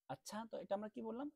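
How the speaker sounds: ordinary talk, nothing marked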